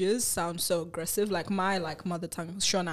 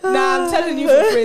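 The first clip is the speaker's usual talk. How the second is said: laughing hard; 8 semitones higher, 10 dB louder than usual. Real, not acted. Laughing fit mid-discussion.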